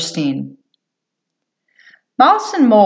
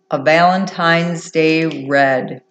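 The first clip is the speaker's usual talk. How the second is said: plain reading voice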